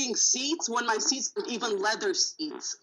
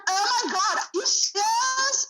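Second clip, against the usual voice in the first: mock disbelieving passenger; 9 semitones higher, 3 dB louder than usual. Driver imitates the rider she is kicking out, incredulous. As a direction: stretch it out high, mocking her disbelief.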